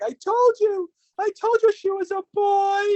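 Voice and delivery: high mock voice of a confused mom; mocking mother. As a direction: squeal it out like a clueless parent.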